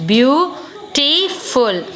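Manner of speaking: "beautiful" drawn out very slowly, beau-ti-ful; slow syllable by syllable. Shown as a demonstration. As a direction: stretch out "beautiful" so each syllable is heard.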